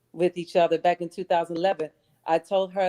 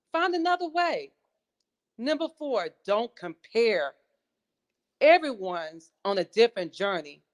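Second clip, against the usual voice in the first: high-pitched, declaimed motivational list, falling at phrase ends; audition recitation; 6 semitones higher than usual. Acted. Contestant resuming her audition on the seven rules, high declaimed recitation. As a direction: proclaim each rule with spaced, emphatic phrasing.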